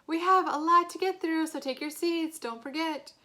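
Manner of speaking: high, loud teacher yell; annoyed yelling. A narrator's reading. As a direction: make them hear you over the crowd. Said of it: "Miss Rubin started yelling louder now".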